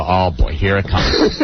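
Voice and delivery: low, resigned dread. Retold or imitated. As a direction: mutter it bracing for trouble. Retold inner dread.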